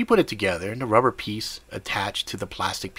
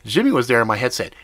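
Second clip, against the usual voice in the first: usual speaking voice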